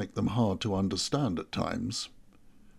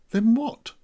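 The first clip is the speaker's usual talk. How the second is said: much higher, louder exasperated demand; 12 semitones higher, 6 dB louder than usual. Acted. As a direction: throw up your hands, out of patience. Exasperated governor demands answer.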